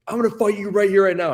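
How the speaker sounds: high, loud imitation of a belligerent drunk; mock screamed threat. Drunk student threatening him in the hallway, mock screaming. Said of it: "he was just screaming at me".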